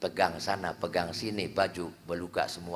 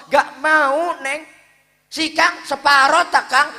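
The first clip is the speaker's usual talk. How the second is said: pleading woman; 15 dB louder, slower than usual. Acted.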